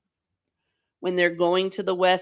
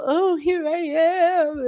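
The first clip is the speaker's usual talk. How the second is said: mock Arwen; 11 semitones higher, slower than usual. Mocking Arwen's movie scenes.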